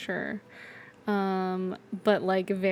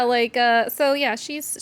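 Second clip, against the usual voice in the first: ordinary talk, nothing marked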